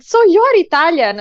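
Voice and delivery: high, loud imitation of nosy questioners; mock astonished question. Everyone quizzing the Italian newcomer, mock astonished. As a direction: pitch it up as a mildly exaggerated query.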